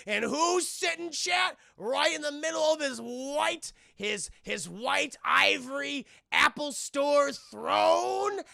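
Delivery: slow, high and loud suspense; dramatic build. Real, not acted. Streamer building suspense for chat, slow loud high. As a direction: build up to the reveal.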